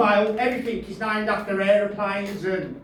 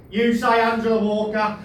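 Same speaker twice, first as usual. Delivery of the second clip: normal talking voice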